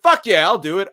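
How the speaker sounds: high, loud cocky agreement; imitating his own young self, pumped and loud; eager yell. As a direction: jump at the stunt like a fired-up kid. Young actor's eager reply retold.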